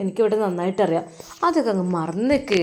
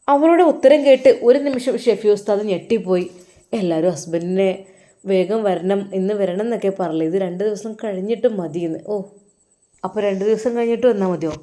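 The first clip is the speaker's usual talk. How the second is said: usual speaking voice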